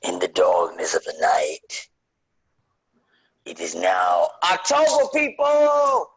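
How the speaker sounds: low booming intro, shouting people; dramatic announcer voice. Acted. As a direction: open the show like a horror trailer. Dramatic intro, Mingo's segment opener.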